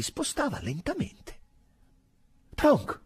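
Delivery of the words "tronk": high, loud, urgent call of the name; agitated call. A narrator's reading. Drogo calls his sergeant on the dark wall, agitated. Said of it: "chiamò Drogo in tono agitato".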